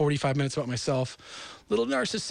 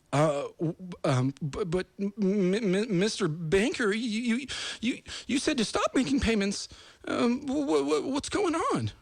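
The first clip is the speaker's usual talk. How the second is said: high, slow stammering borrower; high stuttering mock confusion at the banker; flustered stammer; 7 semitones higher than usual. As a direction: play a bewildered borrower caught off guard.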